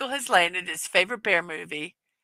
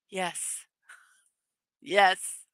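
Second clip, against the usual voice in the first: ordinary talk, nothing marked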